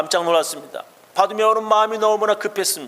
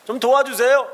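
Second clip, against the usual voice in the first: calling for help; 7 semitones higher, 4 dB louder than usual. Acted. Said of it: "작은 소리로 말하진 않습니다".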